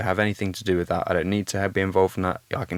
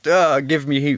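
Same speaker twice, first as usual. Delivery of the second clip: a mock exasperated outburst, higher and louder; exasperated duh; 6 semitones higher, 5 dB louder, slower than usual. To his late brother, a louder mock-frustrated duh. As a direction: blurt it like you want to shake sense into him. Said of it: "I wanna shake Ben and go".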